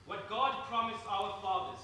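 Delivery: ordinary talk, nothing marked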